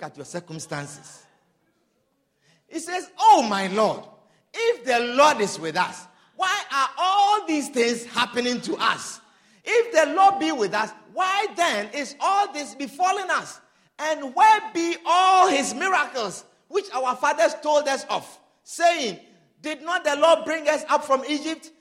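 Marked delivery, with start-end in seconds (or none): 3.2-13.6 louder, pleading cry of Oh, my Lord; loud anguished plea. Acted. Preacher voicing Gideon's protest to the angel, loud and pleading. Gideon's complaint retold, stronger. 14.0-21.7 louder and higher, driving the protest; loud rising protest. Acted. Preacher voicing Gideon demanding the miracles, loud.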